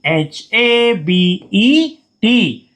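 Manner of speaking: letters spelled very slowly, then the word; teacher dictating spelling. Shown as a demonstration. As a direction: dictate it slowly so students can write. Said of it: "उसको बार बार repeat करेंगे".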